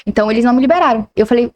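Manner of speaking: ordinary talk, nothing marked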